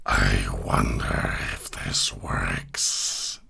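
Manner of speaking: deep, slow, hissing robot whisper; robot dragon snake voice. Shown as a demonstration. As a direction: whisper like a mechanical serpent. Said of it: "so that's Robot Dragon Snake".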